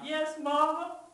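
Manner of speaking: high, loud tearful wail; crying. A narrator's reading. Child confesses to Mama, high tearful cry. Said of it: "I can't help crying now".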